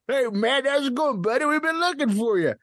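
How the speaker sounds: calling out. Retold or imitated. Calling out to supposed Matt.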